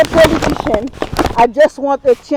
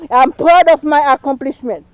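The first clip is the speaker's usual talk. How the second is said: normal talking voice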